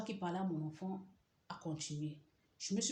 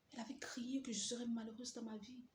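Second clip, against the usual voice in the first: whisper; 5 semitones higher, 7 dB quieter than usual. Retold or imitated. Repeated thought.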